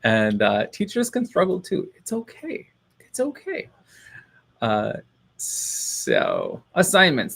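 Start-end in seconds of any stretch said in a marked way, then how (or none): 1.9-3.7 high, soft little reassurance; self-soothing. Pretend. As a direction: coo it lightly in a raised voice. Self-soothing after admitting struggle.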